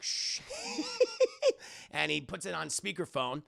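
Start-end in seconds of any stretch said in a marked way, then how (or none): 0.0-1.9 hushing, then very high giggle; shush, then titter like a gleeful villain; sneaky shush into squeaky villain laugh; Joker shushing giggle. Acted. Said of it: "He goes like".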